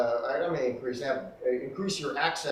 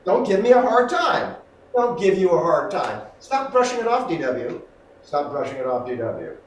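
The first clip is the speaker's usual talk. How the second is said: louder and higher, escalating; heated repetition; 4 semitones higher, 6 dB louder than usual. Shown as a demonstration. Instructor escalating the exercise, loud and heated. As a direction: argue it back hotter each time. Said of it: "then I'm gonna go".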